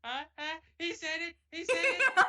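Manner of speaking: high, loud, laughing squeal; excited. Real, not acted. Player squealing because the title got said. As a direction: squeal with delight.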